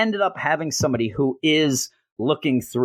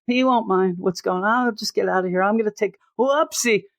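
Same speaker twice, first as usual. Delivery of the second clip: high, airy, oblivious chatter; high breezy carefree rambling; dazed Kirk voice; 9 semitones higher than usual. Retold or imitated. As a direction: play a spaced-out guy wandering off. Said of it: "That's how he's going around".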